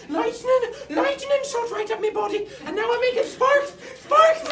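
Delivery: high-pitched frantic yell, mimicking an Irish schoolboy; hammed-up shrieking lad; Irish boy yelling. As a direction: howl it loud and very high with muffled speech. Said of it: "the only Irish student".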